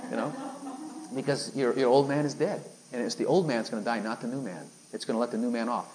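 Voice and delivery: ordinary delivery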